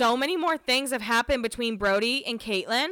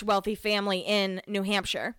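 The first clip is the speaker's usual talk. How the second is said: normal talking voice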